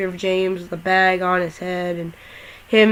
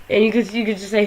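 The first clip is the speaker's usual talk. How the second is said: ordinary talk, nothing marked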